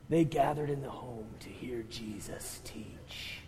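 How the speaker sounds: slow, low mock English accent; stately English-accented Bible-movie narration. Pastor narrating like a Bible movie, English accent. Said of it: "they speak with an English accent".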